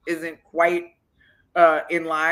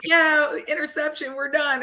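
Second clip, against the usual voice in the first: much higher, happy it's over; excited celebration; 9 semitones higher than usual. Retold or imitated. She celebrates the interception to the coach, excited and higher. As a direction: celebrate the turnover and head off.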